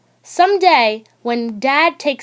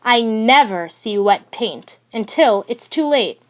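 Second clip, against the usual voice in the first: usual speaking voice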